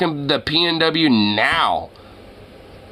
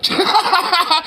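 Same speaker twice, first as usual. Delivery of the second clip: high burst of laughter; incredulous laughter. Real, not acted. Streamer hearing of a Yankee accusation, incredulous high laughter. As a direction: crack up in disbelief.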